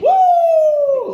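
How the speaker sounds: high loud whoop; excited cheer. Real, not acted. Hype cheer.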